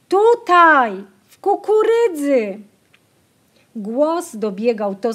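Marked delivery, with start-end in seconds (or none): 0.1-2.6 high, loud call back. A narrator's reading. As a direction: answer the lost girl so she can follow. Answering a lost sister.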